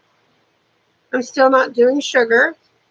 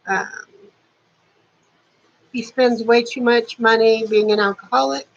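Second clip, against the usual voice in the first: ordinary talk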